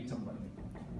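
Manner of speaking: ordinary delivery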